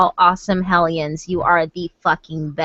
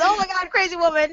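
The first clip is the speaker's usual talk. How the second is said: quick high mock gasp; imitating Renee; 10 semitones higher, faster than usual. Imitating Renee's reaction in the game, quick and high. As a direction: toss it off fast like a startled friend. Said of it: "She goes".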